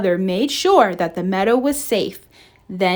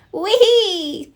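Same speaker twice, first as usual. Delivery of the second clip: gleeful whee; 11 semitones higher, 3 dB louder than usual. Acted. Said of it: "Thumper laughed as he slid".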